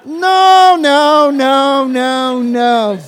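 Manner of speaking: a big playful denial that trails off; mock protest. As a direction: cry out no, no, no, hammed-up and playful.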